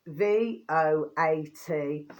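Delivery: V O A T spelled letter by letter, slowly; slow, deliberate spelling of V O A T. Shown as a demonstration. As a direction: spell V O A T out one letter at a time. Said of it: "so it's not as in vote".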